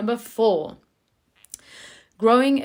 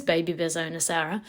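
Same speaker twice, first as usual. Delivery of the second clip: usual speaking voice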